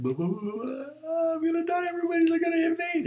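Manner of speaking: very high, loud wailing freak-out; hysterical mock panic. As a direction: wail it like doom has come. Peak of his retold panic.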